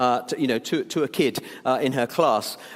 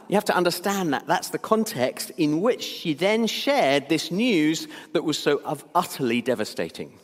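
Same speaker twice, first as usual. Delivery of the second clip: ordinary talk, nothing marked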